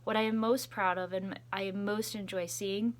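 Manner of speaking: ordinary delivery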